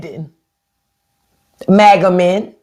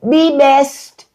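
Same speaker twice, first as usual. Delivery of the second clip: higher, put-on Slovenian-tinged "Be best"; mock first lady accent; 7 semitones higher than usual. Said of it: "Ain't that what the first lady say".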